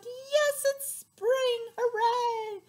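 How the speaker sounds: high, loud and thrilled; a bunny cheering for spring; excited bunny. Acted. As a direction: squeal it like a delighted bunny. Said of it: "She's like".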